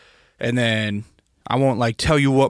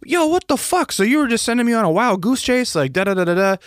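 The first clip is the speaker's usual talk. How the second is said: very high exasperated mock yell; retold outrage; 11 semitones higher than usual. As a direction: fling it up high at the saleswoman. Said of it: "I'm like".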